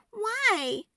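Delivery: put-on indignant little girl. A narrator's reading. Girl questioning mom, high and indignant. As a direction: challenge mom. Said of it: "Sophie asked".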